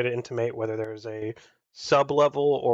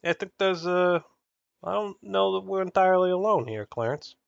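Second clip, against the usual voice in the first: higher, halting delivery; uneasy character; 7 semitones higher than usual. Acted. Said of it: "so he's just like".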